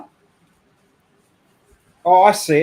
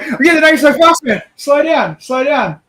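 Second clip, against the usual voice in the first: higher, louder mock-frantic streamer voice; mock panicked streamer; 8 semitones higher, 7 dB louder than usual. Mocking the raided streamer's reaction, high loud panic. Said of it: "He's like".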